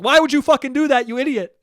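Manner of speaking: put-on grabbing the kid at the mall, yelling fast; retold furious yell. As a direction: scream it in his face.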